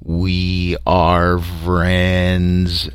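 very slow monotone alien chant; droning, drawn-out alien greeting; booming creature drone. A narrator's reading. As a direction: drone each word out long on one note. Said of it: "suddenly boomed out".

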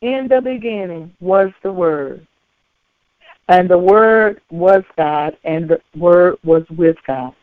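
low, measured verse with weighty pauses. Shown as a demonstration. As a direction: let each phrase settle before the next. Same verse again, slowly and deliberately.

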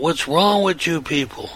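imitating a frantic mild yell; retold agitated yell. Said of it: "he yells out".

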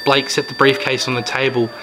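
ordinary delivery

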